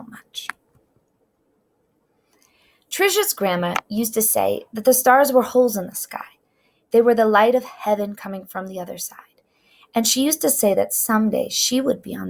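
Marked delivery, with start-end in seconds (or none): none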